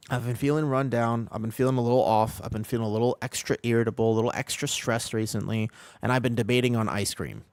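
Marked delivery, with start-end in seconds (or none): none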